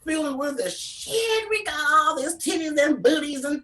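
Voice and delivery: way up high, gushing with pride; hyped up. Real, not acted. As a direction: brag it out, squealing with joy. Excited bragging about body positivity.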